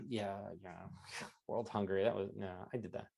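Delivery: very soft, low, dismissive mumble; modest, low-energy brush-off; playing down achievements. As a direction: shrug it off under your breath. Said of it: "don't put down anything you've done".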